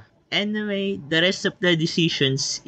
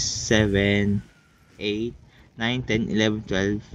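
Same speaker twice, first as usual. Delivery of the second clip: usual speaking voice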